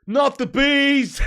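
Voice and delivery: full-volume drawn-out scream, pitched high; hammed-up movie scream, breaking into a laugh; mock scream. Streamer quoting a film line, high screamed panic in jest. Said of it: "Do Nicolas Cage".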